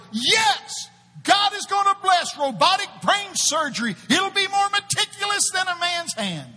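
high, loud prophetic proclamation; preaching shout. Retold or imitated. Preacher reenacting his prophecy to the crowd, shouting. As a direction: declare it from the pulpit. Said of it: "all of a sudden I go".

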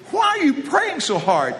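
high, teasing put-on voice; mock puzzled question. Preacher asking Mike at the meal, high teasing voice. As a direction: ask it high and incredulous. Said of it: "I said".

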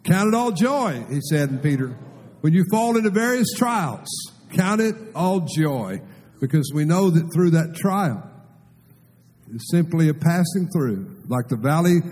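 full-force preaching. Acted. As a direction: preach it out with fire. Preaching at full force over music.

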